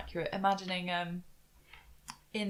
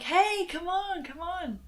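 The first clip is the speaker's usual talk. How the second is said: excited calling out; 9 semitones higher than usual. Acted. Player calls companion to see automaton, excited. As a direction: coax someone over with a high singsong call.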